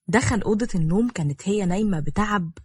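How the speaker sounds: ordinary delivery, nothing marked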